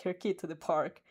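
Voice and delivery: plain reading voice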